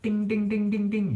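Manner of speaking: very high sung ding. Shown as a demonstration. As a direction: sing ding high like a top string. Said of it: "as compared to how it was".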